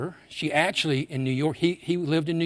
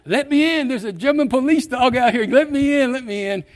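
high, loud imitated panic; mock scream of frightened hotel guests; panicked screaming; 11 semitones higher, 9 dB louder than usual. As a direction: cry out to be let in, hammed-up terror. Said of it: "people are screaming and banging on the door".

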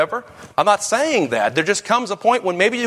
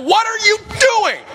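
exasperated yell; 3 dB louder than usual. Retold or imitated. Parent yelling at kids.

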